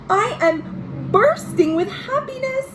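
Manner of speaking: high, eager and delighted; a child gushing with joy in her letter; bursting with joy. A narrator's reading. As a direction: let the happiness spill out.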